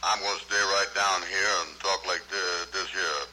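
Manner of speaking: deep-pitched character with a dialect; trying out a low comic character. Acted. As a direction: stay right down low, in dialect. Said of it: "put a little dialect in it".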